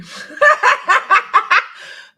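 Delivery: loud high burst of laughter; big laugh. Real, not acted. Laughing hard while reading chat comments aloud. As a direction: cracks up loudly at the comment. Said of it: "Me too".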